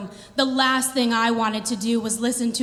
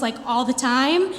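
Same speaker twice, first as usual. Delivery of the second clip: usual speaking voice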